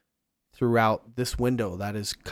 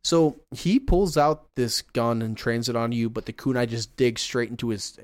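ordinary delivery

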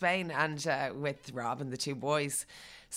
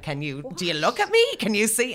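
louder, rising in disbelief at the end; to her husband in the delivery room, exasperated and louder; 5 semitones higher, 6 dB louder than usual. Retold or imitated. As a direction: demand that he actually look at her.

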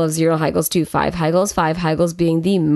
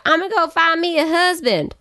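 mock Southern accent; 9 semitones higher than usual. Mocking the heroine's sudden Southern accent, pitched high. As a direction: ham up a Southern belle twang. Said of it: "why all of a sudden do you sound like that".

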